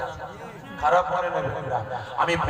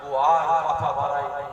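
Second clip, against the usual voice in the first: usual speaking voice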